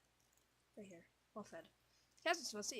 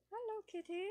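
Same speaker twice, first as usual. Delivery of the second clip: very high sing-song hello; cute high greeting; 13 semitones higher, 4 dB louder than usual. Real, not acted. Player cooing at the cat, high cute greeting. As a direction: coo at the kitty.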